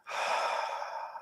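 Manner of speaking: soft audible sigh; demo exhale. Shown as a demonstration. Teacher showing a full breath, soft sigh. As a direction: let the breath fall out. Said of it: "feel how full breath".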